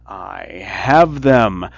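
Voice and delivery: loud, slow gloating witch voice; mocking witch. A narrator's reading. Witch gloating as the children approach, loud malicious glee. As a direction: crow with malice over the catch. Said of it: "laughed with malice and said mockingly".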